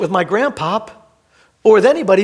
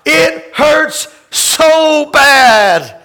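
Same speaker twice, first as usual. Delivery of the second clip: slow, louder, higher cry of pain; anguished cry; 3 semitones higher, 5 dB louder, much slower than usual. Acted. Pastor voicing Jesus in pain, anguished cry. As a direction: drag it out in anguish. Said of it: "Jesus cries out".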